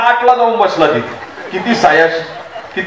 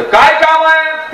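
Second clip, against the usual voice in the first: drawn-out, high-pitched mock annoyance; angry; 7 semitones higher, slower than usual. New husband to wife's brother, mock angry. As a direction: stretch it out high, as an irritated husband. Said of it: "हे रागानं त्याच्याकडे पाहते".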